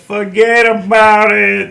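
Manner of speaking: slow, loud New York "forget about it"; New York accent. Pretend. Joking about New York, slow loud New York accent.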